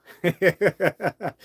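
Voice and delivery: high, loud staccato laugh; loud cackle. Real, not acted. Vlogger amused by his own Southern act, high loud laugh. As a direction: cackle high after the whoop.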